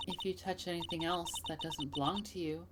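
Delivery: lightly voiced soft-spoken threat; quiet menacing hostess. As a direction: keep it soft and measured. Voices a quiet game character.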